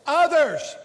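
OTHERS punched high and loud; emphasis on last word. Shown as a demonstration. Preacher leading congregation's verse reading, emphatic on Others. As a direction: hit "Others" hard as a standalone word. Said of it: "with emphasis on the last word".

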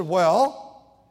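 a loud, rising demanding "Well?". Retold or imitated. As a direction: demand it loudly, expecting something. Waiting for wife's apology.

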